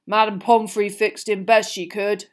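lightly voiced big man, dejected; Hagrid, dull. A narrator's reading. Gamekeeper reports on injured pupil, dully. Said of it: "said Hagrid dully".

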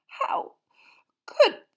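choking back tears, breaking up; choked sob. Acted. Hermione to Ron and Harry, choking on tears. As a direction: voice cracking high and loud on could. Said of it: "she choked".

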